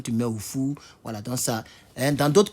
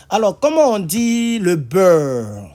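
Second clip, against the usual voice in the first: annonce traînante; 5 semitones higher, 7 dB louder, slower than usual. Real, not acted. Annonce théâtrale du mot suivant.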